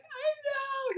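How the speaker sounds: high, tearful wail; mock crying. Wife answering husband who notices her tears, mock-crying wail. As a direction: wail it like a hammed-up sob. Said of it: "you're crying".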